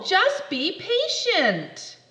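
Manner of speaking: drawn-out fed-up reminder; slow exasperated. Acted. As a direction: spell it out firmly.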